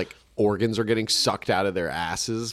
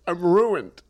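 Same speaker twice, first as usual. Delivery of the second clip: despairing gambler; 5 semitones higher, 4 dB louder than usual. Acted. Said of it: "gambling addict".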